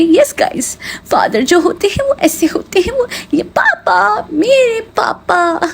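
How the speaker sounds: high, slow, sugary vlogger voice imitation; mocking the vlogger. Mocking a vlogger parading her father, high sugary voice. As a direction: ham up a fake sweet vlogger showing off her dad. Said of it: "अपने dad को भी as a prop use करके".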